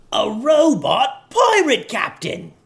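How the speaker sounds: high, slow, excited pirate shout; excited yell. A narrator's reading. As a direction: cheer it out with joy. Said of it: "yelled another pirate excitedly".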